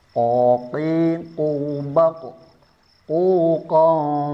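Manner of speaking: slow, sustained recitation drill on a level pitch; praktek tadribat. Shown as a demonstration. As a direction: model each syllable for the class to repeat, drawn out. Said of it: "Saya dulu, nanti diikutin". (contoh bacaan panjang untuk diikuti)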